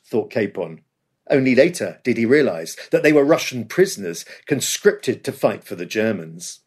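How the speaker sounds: usual speaking voice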